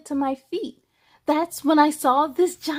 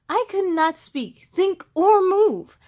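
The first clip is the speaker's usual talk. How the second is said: frozen with fright; 5 semitones higher, 3 dB louder than usual. A narrator's reading. Narrated fright.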